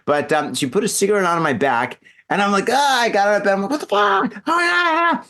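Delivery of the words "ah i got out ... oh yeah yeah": high, frantic retold yelling; freaking out. Cigarette burn on the back, acted freak-out. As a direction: act out a panicked jump from bed. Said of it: "You know, I'm freaking out".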